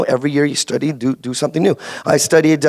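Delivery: normal talking voice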